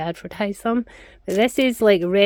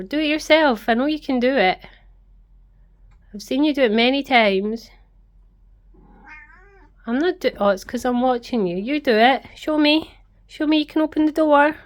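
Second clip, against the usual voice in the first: higher, playful urging; coaxing the cat; 6 semitones higher than usual. Real, not acted. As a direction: egg the cat on to open the door. Coaxing the cat to open door.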